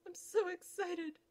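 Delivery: high, slow gushing; high lovestruck gush; gushing excitement. Acted. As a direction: savour every word with glee. Giddy suitor.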